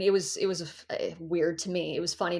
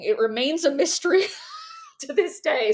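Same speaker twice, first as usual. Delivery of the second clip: higher, breaking into laughter; amused, laughing it off; 8 semitones higher than usual. Real, not acted. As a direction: laugh through the punchline. Laughing at own story.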